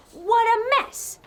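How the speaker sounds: high, slow "what a mess"; high drawn-out disgust. Acted. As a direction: recoil at the filthy bathroom.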